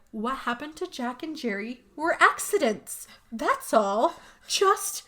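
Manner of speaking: high, slowing, overly insistent denial; shifty insistence. Retold or imitated. Barb brushing off the deaths, high and overly insistent. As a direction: insist too hard, like hiding something. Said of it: "says it like that".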